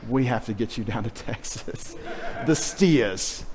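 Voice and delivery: put-on a grandmother chuckling at a child's speech; laughing grandmother. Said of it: "She said".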